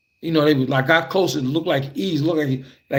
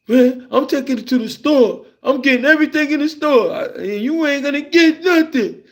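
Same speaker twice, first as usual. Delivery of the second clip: put-on bragging kid; 10 semitones higher than usual. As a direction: gloat like a greedy little boy. Friend gloats about the pocketbook.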